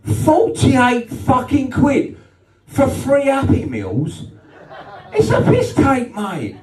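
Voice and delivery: high, slow, wailing complaint that breaks into a shout; mock outraged wail. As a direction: wail it like a man pushed past his limit over the bill. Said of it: "He went".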